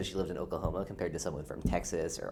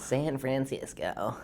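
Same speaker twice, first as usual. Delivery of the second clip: slow, drawn-out American "San Francisco", pitched higher; American accent; 6 semitones higher, much slower than usual. Shown as a demonstration. Repeating the Scottish friend's word in an American accent. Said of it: "it's pretty solid, pretty accurate".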